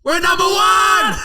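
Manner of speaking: big high victory shout, slowed; roaring celebration of hitting number one; triumphant shout. Real, not acted. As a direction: yell it like you just won the league. Celebrating chart ranking.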